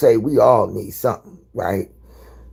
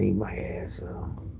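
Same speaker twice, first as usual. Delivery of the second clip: much softer whisper, slow; whispered aside; 4 semitones lower, 14 dB quieter, slower than usual. Real, not acted. Thinking aloud about her friend's husband, whispered. Aside while planning a call.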